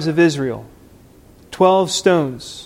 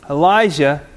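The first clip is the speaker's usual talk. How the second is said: ordinary talk, nothing marked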